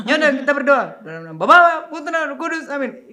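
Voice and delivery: put-on loud, high Batak-accented prayer. Mother starts prayer grudgingly, in Batak accent. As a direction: mimic a cross mother praying in thick Batak accent. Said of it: "Oh, logat Batak tuh".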